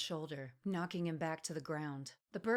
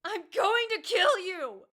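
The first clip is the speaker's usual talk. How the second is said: very high, very loud, slow scream; screaming threat; 13 semitones higher, 11 dB louder, slower than usual. A narrator's reading. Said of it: "she continued screaming".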